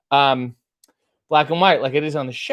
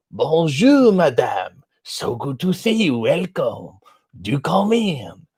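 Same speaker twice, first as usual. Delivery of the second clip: fake French accent; slower than usual. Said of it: "he plays a French guy".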